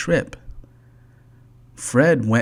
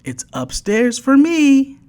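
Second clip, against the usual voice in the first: high, louder playful call; Ted calling out his choice of room, high and loud; storybook yell; 14 semitones higher, 5 dB louder than usual. A narrator's reading. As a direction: call it out happily. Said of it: "yelled Ted".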